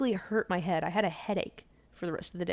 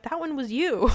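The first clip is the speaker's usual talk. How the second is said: raised, indignant accusation; mad retort; 6 semitones higher, 6 dB louder than usual. Retold or imitated. Blamed employee answering the boss, mad. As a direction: push back loudly on the blame. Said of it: "I kinda got mad".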